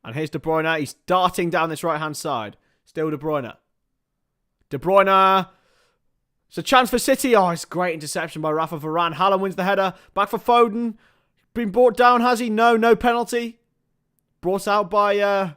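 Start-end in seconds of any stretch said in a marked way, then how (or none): 4.7-15.5 high, loud excited match commentary; urgent football commentary at full voice; live play-by-play. Real, not acted. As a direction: call the chance as it happens, voice raised.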